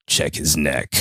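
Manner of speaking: low-pitched pretend creepy onlooker; creepy watching doctor. Imagined doctor watching from the dark, giving an order as a mock creep. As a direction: order it like a shadowy voyeur, in jest. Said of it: "slowly smoking a cigarette in the dark".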